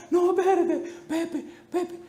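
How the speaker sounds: high, squealing plea of a beaten bully; squeaky pleading bully. Retold or imitated. Preacher mimics the bully begging Pepe to stop, high squeal. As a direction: squeal for mercy like the thug being pummeled.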